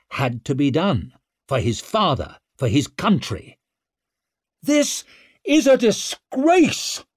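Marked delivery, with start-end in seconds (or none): 4.6-7.0 very high, loud, slow denunciation; drawn-out cry of indignation; outraged shout. A narrator's reading. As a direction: denounce it to the whole chamber. Leo publicly protesting the trial.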